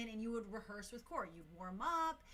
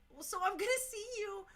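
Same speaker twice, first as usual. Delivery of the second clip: high, louder, pleading question; retold emotional squeal; 9 semitones higher, 5 dB louder than usual. Dancer asking a fan about Tuesday rehearsal, high and emotional. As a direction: squeak it out as if overcome. Retelling tearful talk with fan.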